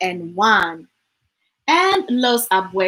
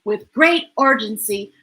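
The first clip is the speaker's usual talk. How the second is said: usual speaking voice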